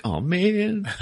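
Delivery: high, laughing oh man; a jokey wail at the wrecked car; mock dismay. Flash watching his car crash, high laughing dismay.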